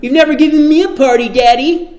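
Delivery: put-on fast, high, loud childish whine; whiny self-righteous son. Older brother whining to Daddy about the party, fast and high. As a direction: pout it like a spoiled son. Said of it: "That's how I interpret it".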